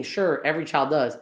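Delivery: ordinary delivery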